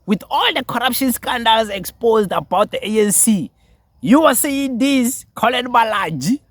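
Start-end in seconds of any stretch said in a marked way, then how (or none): 4.0-6.4 incredulous mockery. Real, not acted. Mocking disbelief at the speaker.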